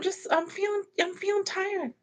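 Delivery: high, soft tired whine; soft high-pitched complaint of tiredness; weary little voice. Real, not acted. Owns up to being tired.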